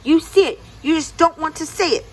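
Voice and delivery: high, loud, stamping-mad insistence; angry Anansi. Acted. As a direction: snap at the deer in a fit of pique. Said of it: "Anansi stamped his legs".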